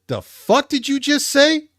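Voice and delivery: high, loud, slow baffled yell; confused reply. Retold or imitated. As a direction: shout it in total disbelief. Confused man replies.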